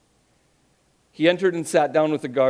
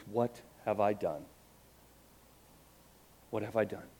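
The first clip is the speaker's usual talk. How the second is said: much softer broken murmur; inner despair; 3 semitones lower, 9 dB quieter than usual. Acted. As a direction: say it to yourself in despair. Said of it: "and he thinks".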